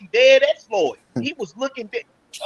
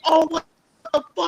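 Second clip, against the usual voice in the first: higher, startled exclamation; retold shock; 8 semitones higher than usual. Telling friends what he blurted ringside, startled and high. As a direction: replay your own jolt of disbelief. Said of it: "I said".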